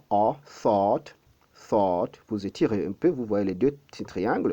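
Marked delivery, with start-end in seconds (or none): 0.1-2.2 "thought" with a long open "A" sound; open vowel demo. Shown as a demonstration. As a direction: drop the jaw and stretch the vowel in "thought". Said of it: "vous produisez un peu le son A".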